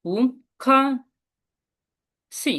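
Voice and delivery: পু rising, খা falling hard, সি light; second, fourth, neutral tones. Shown as a demonstration. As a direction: separate syllables, lift পু and drop খা. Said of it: "second tone হবে".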